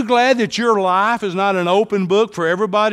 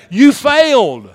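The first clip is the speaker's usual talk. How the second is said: higher, louder, slow You failed; loud call-out; 7 semitones higher, 9 dB louder, slower than usual. Shown as a demonstration. Pastor tells congregation to tell neighbor, loud call-out. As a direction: boom it at your neighbor. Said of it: "Look at your neighbor and say".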